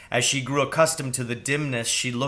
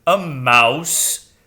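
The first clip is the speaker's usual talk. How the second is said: loud owl voice rising in disbelief; incredulous owl, loud; unbelieving owl; 2 semitones higher, 8 dB louder than usual. Acted. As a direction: doubt it, as a stately owl. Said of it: "sounded unbelieving".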